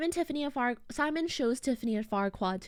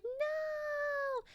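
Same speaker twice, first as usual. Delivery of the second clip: squeaky no; 4 dB quieter than usual. Retold or imitated. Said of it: "And then Tiffany's like".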